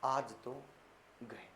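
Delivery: low, soft dread; scared student. Retold or imitated. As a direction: mutter it like you're doomed. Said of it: "वो कह रहा". (डरा हुआ धीमा स्वर)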